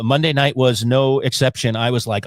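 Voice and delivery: ordinary talk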